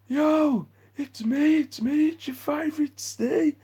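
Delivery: very high, squeaky fan impression; excited fan. Stranger calling out to vlogger in the street, squeaky high excited fan. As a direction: mimic the overeager fan greeting you.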